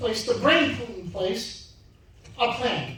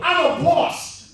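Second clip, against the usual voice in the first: slow, higher shouted affirmation; booming motivational speech; 6 semitones higher, 4 dB louder, slower than usual. Shown as a demonstration. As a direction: call it out like a coach. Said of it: "repeat after me".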